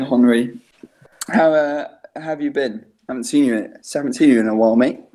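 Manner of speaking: ordinary talk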